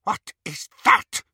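high, loud, deliberate question; alarmed demand. A narrator's reading. Bellatrix alarmed by what she sees.

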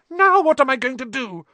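loud, very high distressed wail; shrill. A narrator's reading. Hermione locked out of Gryffindor Tower, shrill. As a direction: demand it shrilly in sudden dismay. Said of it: "she asked shrilly".

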